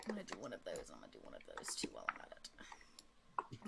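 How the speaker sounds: very low, hushed mumble; muttering to oneself. Real, not acted. Game master thinking aloud over dice, hushed muttering. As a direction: mutter under your breath, almost whispering.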